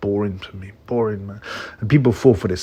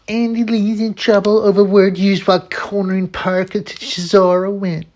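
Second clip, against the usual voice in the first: high-pitched mocking read-out; put-on high voice for an upset writer; mock complaining journalist; 10 semitones higher than usual. Mockingly reading an upset journalist's post.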